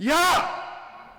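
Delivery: sudden loud, high-pitched yell; urgent shout. Acted. Parent yelling at a toddler near a cliff, urgent shout. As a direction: shout to stop a child in danger. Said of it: "이렇게 소리 지르겠죠".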